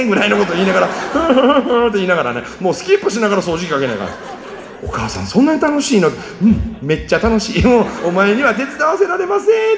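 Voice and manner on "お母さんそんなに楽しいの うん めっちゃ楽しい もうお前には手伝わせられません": higher, laughing playful voice; happy mom. Pretend. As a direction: tease cheerfully while cleaning. Mutter singt beim Putzen fröhlich.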